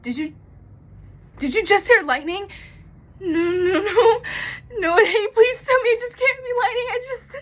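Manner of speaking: high, loud burst of fear; frightened cry, pitch climbing sharply; panicked. Acted. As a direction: plead in rising panic. Hears lightning, panics in monologue.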